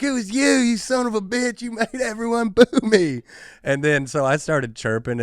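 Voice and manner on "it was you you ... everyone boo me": put-on high, loud drunken accusation; drunk guy accusing. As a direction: screech it like a sloppy drunk who finally recognises you. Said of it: "he was like".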